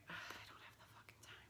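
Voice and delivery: fast frustrated whispered retort; exasperated whisper. Retold or imitated. Answering Spirit's advice with a fast, frustrated whisper. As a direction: hiss it under your breath. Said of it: "and you're like".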